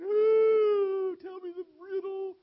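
high, loud wailing plea; whining crying. Acted. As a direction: wail and beg like a spoiled bride. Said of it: "she's whining, crying".

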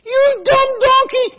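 high, slow furious yell at the donkey; scolding yell; angry yell. Acted. Angry rider yelling at donkey.

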